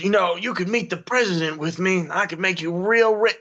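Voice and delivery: high, wheedling bribe; slick corrupt CEO. Acted. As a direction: sweet-talk like a sleazy exec. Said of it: "he goes".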